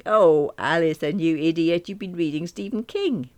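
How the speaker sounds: loud, gleeful teasing; loudly amused, mock-scolding; bursting with amusement. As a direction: exclaim it as if tickled by a friend's blunder. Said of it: "Then she burst out laughing".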